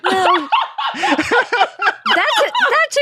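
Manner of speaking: high-pitched, laughing through the words; helpless laughter breaking into the reply. Real, not acted. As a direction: answer while cracking up. Teased about cleaning every day.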